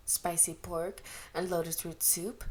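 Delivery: usual speaking voice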